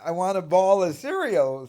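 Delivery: high, loud mocking "ball" for bowl. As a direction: hit the wrong vowel in "ball", meaning bowl. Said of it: "he wouldn't say".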